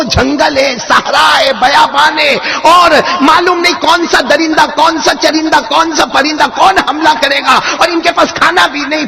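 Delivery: high, loud fervent storytelling; impassioned preaching. Acted. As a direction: preach it to the crowd with full fire. Preaches the scene at full force.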